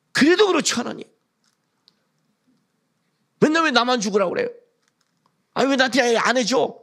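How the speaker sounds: high-pitched mock complaint to God; acted protest. Believer asking God why only they must die, acted protest.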